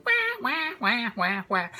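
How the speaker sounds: high, hammed-up wah-wah of a little boy crying; mock crying. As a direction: wail it like a small kid, playfully overdone. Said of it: "heard this little boy crying".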